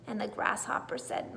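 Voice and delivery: plain reading voice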